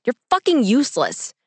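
exasperated insult, pitch jumping up; spat in frustration. A narrator's reading. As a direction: spit it out in frustration. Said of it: "I spat".

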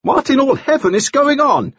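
high-pitched incredulous demand; astonished. A narrator's reading. Nielsen walks in on the quarrel, astonished. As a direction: burst in aghast.

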